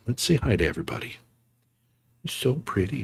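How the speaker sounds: hushed, tender coo to a pet; soft cooing. Real, not acted. Streamer softly cooing to his kitten. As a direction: murmur sweetly to the kitten.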